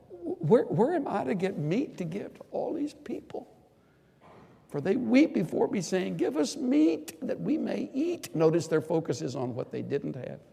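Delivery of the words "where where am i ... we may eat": high, loud, whining complaint; a much higher, loud and put-upon whine; whining Moses. Acted. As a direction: play Moses as a worn-out, whimpering leader.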